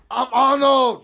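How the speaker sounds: hint of Schwarzenegger, proudly declared; Arnold impression. Insisting to friends he is doing Arnold. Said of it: "You don't like my Arnold?".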